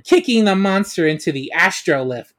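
ordinary delivery

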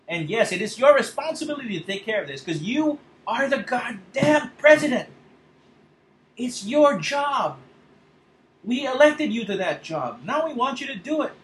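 real angry yell at a leader; furious outburst. Real, not acted. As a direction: rage at the president, full voice. Ranting demand at the president.

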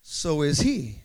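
loud, high-pitched preacher building in urgency; full-force preaching. Acted. Preacher urging the congregation to take up authority, full-force preaching. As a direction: drive it home like a fired-up preacher.

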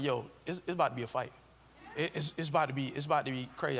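soft, low worried murmur; hushed aside. Retold or imitated. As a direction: lean in and say it under your breath. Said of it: "we was looking at each other like".

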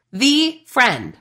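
"The" said as THEE, set apart before friend; stress on THE. Real, not acted. Coach singling out her best friend, stressed THE. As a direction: lean hard on "The", as the one and only.

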